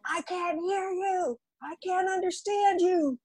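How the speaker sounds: a hint of a strict teacher heckling; teacher calling from back. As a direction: imitate a demanding director calling out.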